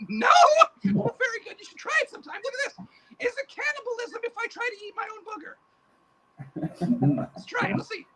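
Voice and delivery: a high cackling boogeyman; boogeyman puppet voice. Acted. Puppet boogeyman greets the interviewer in a high creepy voice. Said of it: "Hey, boogeyman, come over here".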